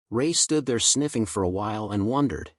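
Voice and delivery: ordinary delivery, nothing marked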